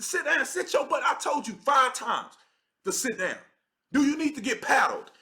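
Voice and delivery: mock telling-off with a threat behind it; acted scolding. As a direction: act out laying down the law to a child.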